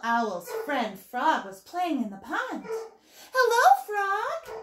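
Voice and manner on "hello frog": high, loud owl greeting; hooting little owl. A narrator's reading. Little Owl greets Frog in the pond, hooting high and loud. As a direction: call out brightly as a little owl, pitched way up. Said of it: "hooted little Owl".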